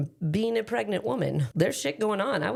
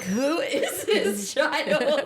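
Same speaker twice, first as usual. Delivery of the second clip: laughing disbelief; 6 semitones higher, much slower than usual. Retold or imitated. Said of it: "I'm like".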